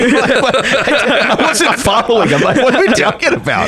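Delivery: laughing high and slow. Real, not acted. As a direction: giggle through the words. Laughing at confusion.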